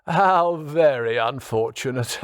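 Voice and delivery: put-on commiserate smugly in a posh British accent; chuckling posh accent. A narrator's reading. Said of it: "Edwards chuckled to himself".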